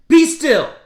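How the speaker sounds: sharp loud high shout, be still; shouting command. Acted. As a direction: Max shouts the monsters down. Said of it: "Till Max said".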